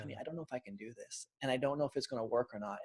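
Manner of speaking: mild hint of tears, hushed and hurried; retold sobbing plea. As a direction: quietly recall breaking down to his wife. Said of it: "I was just sobbing".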